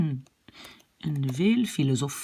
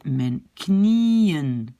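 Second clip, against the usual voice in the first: "mijn knieën" slow, long stressed NIE gliding into -en; accent sur le I. Shown as a demonstration. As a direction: stretch the IE of "knieën" up, then drop. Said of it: "l'accent est sur le I". (« mijn knieën » lent, I long accentué)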